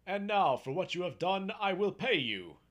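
high, loud promise of reward; calling out a grand promise; grand proclamation. A narrator's reading. Man grandly promises Jack reward.

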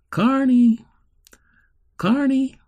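high, loud calling from afar; a man calling. Acted. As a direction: call her name across the distance.

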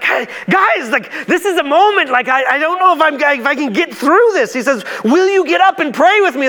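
high, urgent plea to sleepy friends; Jesus pleading with his sleepy disciples. Acted. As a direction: beg them to wake and pray. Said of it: "he's like".

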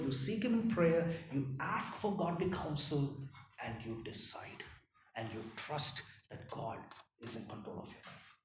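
ordinary talk, nothing marked

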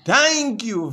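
sudden high, loud cry of thanks. Real, not acted. Pastor thanking God in prayer, sudden loud cry. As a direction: burst out in gratitude.

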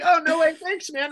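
high, loud, laughing mock-flattered thanks. Comic repeating his flattered reply to the heckler, laughing. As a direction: gush it while cracking up.

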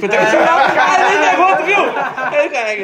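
high, loud burst of laughter through the words; cracking up mid-sentence; laughing hard. Real, not acted. Mocking the friend who denied it, laughing.

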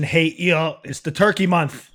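ordinary delivery

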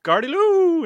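a high, loud yelled warning, retold in fun; mock yell. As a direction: yell it out like a coordinator sending everyone charging. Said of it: "the O C just yelled".

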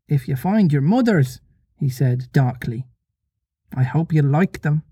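measured, unhurried storybook reading; story narration. A narrator's reading. Reader telling listeners of Peter staying behind, measured story narration. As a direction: read it aloud with deliberate pauses.

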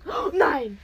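excited shrieks. Real, not acted. Water splashing on clothes.

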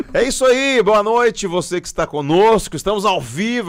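upbeat radio host welcome; podcast host opening. Acted. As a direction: open the show like a host. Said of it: "Faz a abertura de sala de embarque".

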